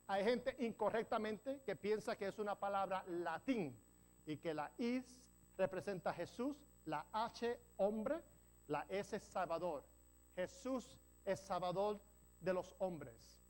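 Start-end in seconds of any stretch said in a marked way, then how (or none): none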